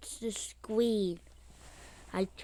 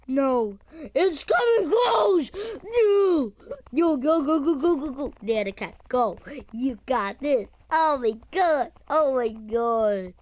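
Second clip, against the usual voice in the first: frantic; 6 dB louder than usual. Real, not acted. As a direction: scream urgings at the screen. Cat chasing, frantic cheering.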